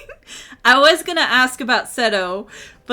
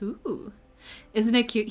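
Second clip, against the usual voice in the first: ordinary talk, nothing marked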